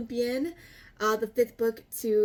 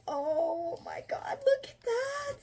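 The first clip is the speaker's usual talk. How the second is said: high, teary gushing; overwhelmed squeal; 13 semitones higher, slower than usual. Real, not acted. Vlogger seeing the book cover, teary high squeal. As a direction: squeal it, almost in tears.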